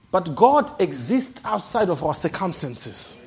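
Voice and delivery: usual speaking voice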